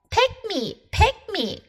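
high, eager plea of a cat; a little cat begging to be picked; eager cry. Acted. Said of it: "cried Cat".

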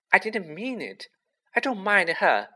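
high, hurried-feeling protest; frantic. A narrator's reading. Girl takes back what she said of the ghost, frantic. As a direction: backpedal anxiously, pitched high. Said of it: "Hermione whispered frantically".

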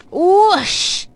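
high, swooping "whoosh"; drawn-out storybook sound effect. A narrator's reading. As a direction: sweep through the word like a skid. Sound word for skidding dog.

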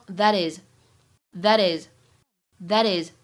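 "that is" slow, t flapped like a d, linked. Shown as a demonstration. Teacher showing the American flap in "that is", slow. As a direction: say "that is" as "tha-dis", slowly. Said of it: "美音浊化".

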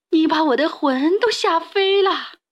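high-pitched frightened complaint; old woman crying out. A narrator's reading. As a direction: exclaim as if scared witless. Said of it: "瘦小的老妇人喊了起来".

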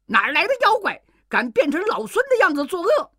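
high, loud angry monkey shout; furious scolding. A narrator's reading. Wukong scolds the fake monkey, high furious shout. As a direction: scold the impostor with fury. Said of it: "悟空大怒， 骂道".

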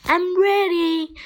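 mild high shout. A narrator's reading. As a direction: call out eagerly, voice lifted. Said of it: "he shouted".

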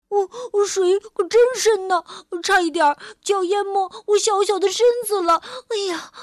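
high, fast, breathless little voice; small creature panicking in water; little dinosaur voice. Acted. Little dinosaur struggling in deep water. (小恐龙慌张的尖细声音)

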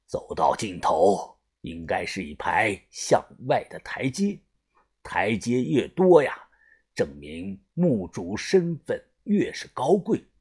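low, soft, unhurried elder-leader voice; the boss calmly explaining, deep and hushed; measured crew boss. Acted. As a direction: drop low and quiet, explain with authority.

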